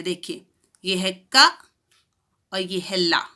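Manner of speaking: normal talking voice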